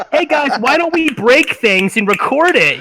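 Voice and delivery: mock a mom egging the kids on; mocking boomer mom. Mocking the mom who films kids breaking things, sarcastic mom voice. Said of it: "Great job, Mom".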